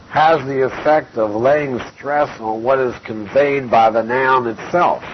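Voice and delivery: ordinary delivery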